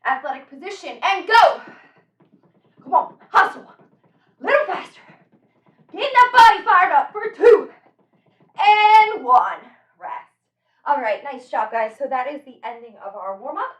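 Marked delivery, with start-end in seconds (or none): 1.0-9.6 loud high hustle calls; barking gym coach. Real, not acted. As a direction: fire the class up. Coach urging hustle, counting down.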